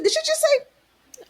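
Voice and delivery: high, slow shocked question; shocked disbelief. Retold or imitated. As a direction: gasp it out in disbelief. Shocked at the P word.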